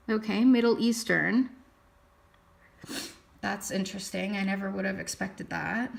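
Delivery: ordinary talk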